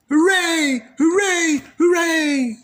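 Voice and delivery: put-on high, loud kids' cheer; cheering shout. A narrator's reading. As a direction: cheer like a happy crowd of kids. Children cheering.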